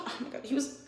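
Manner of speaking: usual speaking voice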